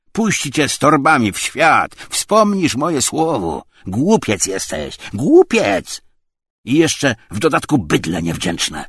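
loud, high, aggrieved tirade; indignant rant. A narrator's reading. Tarantiew berates Obłomow for ingratitude, indignant rant. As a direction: pile on reproaches, raising the voice. Quarrel, visitor rants at ungrateful host.

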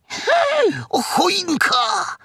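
very high, startled squeal; piglet crying out. A narrator's reading. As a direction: exclaim in a high, drawn-out piglet voice. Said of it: "zawołał Prosiaczek".

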